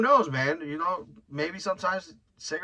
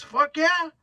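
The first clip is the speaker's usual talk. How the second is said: eager yell; 14 semitones higher, 6 dB louder than usual. Retold or imitated. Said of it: "He goes".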